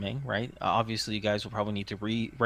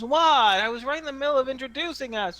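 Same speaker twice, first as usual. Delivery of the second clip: put-on high, quick protesting kid; little tyke; 6 dB louder, faster than usual. Kid leader interrupted while introducing everyone, high child voice. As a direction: protest fast and high like a small boy. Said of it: "a gap-toothed little tyke".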